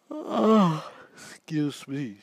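words stretched through a yawn; a real mid-sentence yawn, slowed. Real, not acted. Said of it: "Sorry for the yawn".

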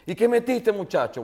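a hint of an irate Venezuelan dad; mock angry father. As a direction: play the shocked, annoyed father. Angry father voiced in story.

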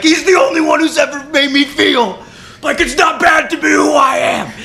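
high loud cry half-laughing; hammed-up emotional shout; laughing heartfelt shout. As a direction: bellow the confession dramatically. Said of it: "And Red's like".

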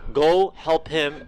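slow, drawn-out command; mom's insistent order. Retold or imitated. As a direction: stretch each word like an insistent mom. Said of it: "my mom just like".

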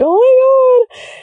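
very high, drawn-out mock squeal; mock emotional chills. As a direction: squeal it slowly, as if chills hit you. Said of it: "those little chills in your body".

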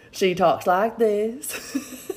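slow, stretched South Carolina drawl; a light imitation of a slow South Carolina drawl; Lynn's Southern drawl. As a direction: draw it out like a South Carolina friend. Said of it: "she has that drawl, y'all".

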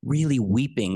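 ordinary talk

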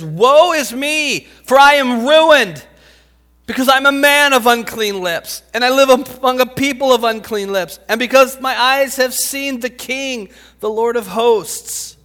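loud, high wail of woe; anguished outcry. Acted. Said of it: "some expression behind his writing".

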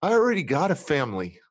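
a triumphant proud declaration; proud defiance. Real, not acted. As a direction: lift up, proud of his family. Proud defiance about his family.

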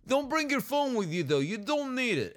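lightly acted New York accent. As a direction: coax them in a put-on New York voice. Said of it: "this is a New Yorker that's telling me".

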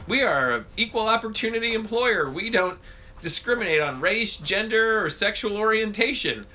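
lightly imitated official policy, pitched high; mock company statement. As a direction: recite it like a mock employer notice. Mimicking an employer's official statement.

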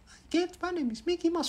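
soft, very high falsetto; Mickey Mouse. Retold or imitated. Child copying the TV cartoon, soft falsetto. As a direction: copy the cartoon squeak quietly. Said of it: "Gue tiruin".

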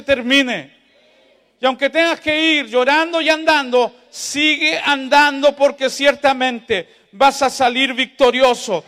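high, loud, shouting revival climax; preaching at full force. Acted. Pastor exhorting the grieving congregation to keep walking, full-force preaching. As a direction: preach it out, rising to a roaring amen.

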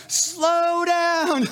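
high, loud imitation of the wife yelling; wife on the speeding tandem, mock yelling. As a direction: squeal it like a scared passenger. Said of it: "she's yelling".

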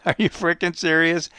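incredulous New Yorker, laughing into it; New York accent. Retold or imitated. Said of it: "New York, uh, accent".

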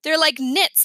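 plain reading voice

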